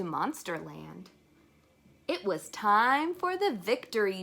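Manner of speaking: put-on lively read-aloud to children; story narration. A narrator's reading. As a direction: read it out bright and bouncy for kids. Reading a picture book aloud.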